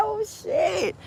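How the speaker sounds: very high-pitched laugh running into the words; real laughter. Real, not acted. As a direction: crack up and squeal it out through laughter. Cracks up at own crucifixion remark.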